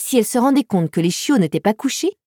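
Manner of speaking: ordinary delivery, nothing marked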